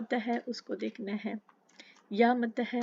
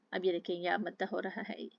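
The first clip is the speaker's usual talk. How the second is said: normal talking voice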